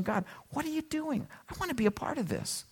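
high, very soft pleading wonder; whispery-light, earnest request to God; hushed prayer. Acted. Believer looking around and asking God, hushed prayer.

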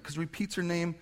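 ordinary talk

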